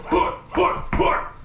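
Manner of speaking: breathy clipped war grunts; Spartan battle grunts. Retold or imitated. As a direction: chant like soldiers ready for battle. Spartan troops' battle cry.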